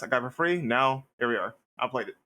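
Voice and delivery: ordinary talk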